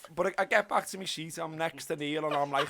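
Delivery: ordinary talk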